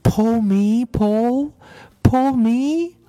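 very high, tiny coaxing voice; high little voice, each plea drawn upward; little pigtail voice. A narrator's reading. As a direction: plead like a tiny talking pigtail. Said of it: "a little voice coming from the pigtail".

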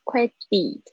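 "credit" said wrongly as "cre-DEE"; wrong ending. Shown as a demonstration. Teacher shows students what it is not, wrong ending. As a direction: drag the end of "credit" into a long ee. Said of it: "不是credit".

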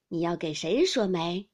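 ordinary delivery, nothing marked

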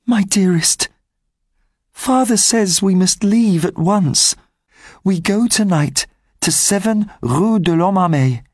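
put-on high, tender girl's voice reading; young girl Cosette. Cosette writing farewell to Marius, high tender voice. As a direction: read it as a loving girl's letter, up high.

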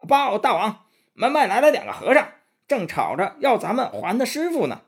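high, fast squeaky demon report; a breathless little minion blurting the news; hurried little demon. A narrator's reading. Little demon rushing in to tell the king, high and fast. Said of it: "小妖怪却急急忙忙跑进来".

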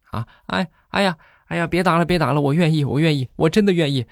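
high-pitched yelps of stop hitting me; a groom cringing under punches, pleading. Acted. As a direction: beg her to stop the blows. Bride punches groom, he begs.

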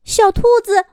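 high, loud cry of alarm. A narrator's reading. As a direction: call out in sudden shock. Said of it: "小公主惊叫了一声".

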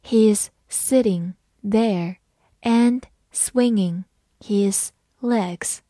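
word by word, with clear gaps; very slow, deliberate model sentence; slow reading. Shown as a demonstration. Teacher reads the example for learners, slowly word by word. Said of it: "好，慢读一遍".

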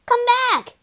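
high-pitched call after someone; urgent high cry to a runaway friend. A narrator's reading. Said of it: "he cried".

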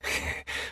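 breathy unvoiced little laugh; a quiet knowing chuckle; soft chuckle. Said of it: "呵呵地笑了起来". (轻声呵呵一笑)

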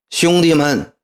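high, loud call to the troops; loud address. A narrator's reading. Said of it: "大声说". (高声喊话)

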